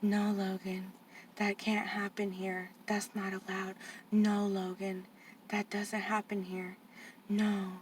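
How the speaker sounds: soft, slow, hushing him down; hushed staff lady. Retold or imitated. Aunt imitating staff hushing Logan, soft and slow. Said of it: "the lady's going".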